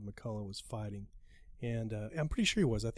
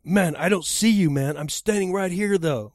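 louder, higher imitation of an exasperated caller; exasperated quote; 6 semitones higher, 10 dB louder than usual. Fighter on the phone to the driver who can't find him, exasperated. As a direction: insist loudly, as an impatient caller would. Retold airport phone argument.

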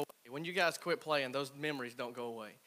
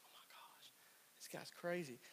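mock whisper; 15 dB quieter than usual. Retold inner thought, whispered.

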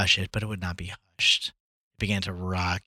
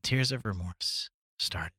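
whispered; 7 dB quieter than usual. A narrator's reading. Quiet ending of story.

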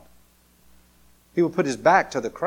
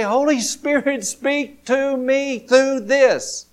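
slow, drawn-out plea; prayer; 10 semitones higher, 4 dB louder, much slower than usual. Shown as a demonstration. Preacher models a prayer to the Spirit, slow and pleading. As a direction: pray it slowly, word by word.

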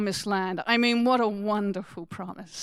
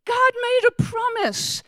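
much higher, incredulous; astonished; 11 semitones higher, 3 dB louder than usual. Real, not acted. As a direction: sound disbelieving. Preacher astonished at unkept promise.

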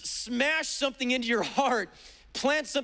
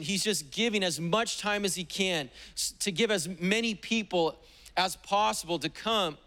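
usual speaking voice

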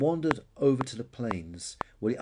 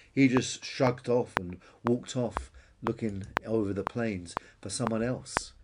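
ordinary delivery, nothing marked

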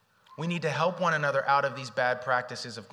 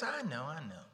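soothing reassurance; 6 dB quieter than usual. Pretend.

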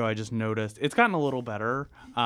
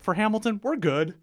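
confident [name]; 10 semitones higher, 6 dB louder than usual. Retold or imitated. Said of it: "then he's like".

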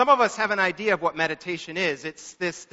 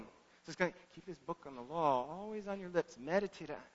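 murmured scripture recitation; mumbling; 14 dB quieter than usual. Shown as a demonstration. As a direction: mumble the verse to yourself like reciting it. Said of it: "I'm mumbling".

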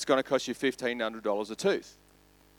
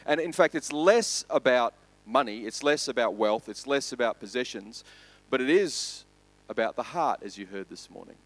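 usual speaking voice

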